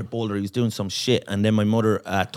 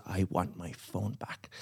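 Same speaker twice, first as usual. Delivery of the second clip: quiet menacing demand; 6 semitones lower, 16 dB quieter, slower than usual. Retold or imitated. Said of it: "and he goes".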